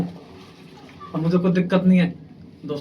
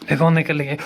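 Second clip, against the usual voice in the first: normal talking voice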